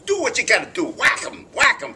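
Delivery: loud, high New York tough guy; hammed-up New York accent. Shown as a demonstration. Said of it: "I had to do a New York accent".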